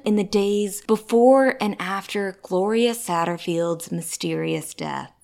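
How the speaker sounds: investigative news exposé. Acted. Loud dramatic news narration.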